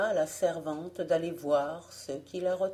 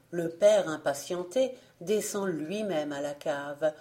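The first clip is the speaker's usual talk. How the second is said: usual speaking voice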